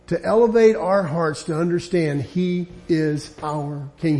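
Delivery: usual speaking voice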